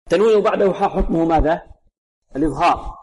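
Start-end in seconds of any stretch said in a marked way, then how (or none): none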